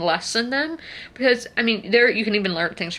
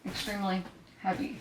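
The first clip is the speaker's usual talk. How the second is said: mock strain; 11 dB quieter, faster than usual. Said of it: "Not really".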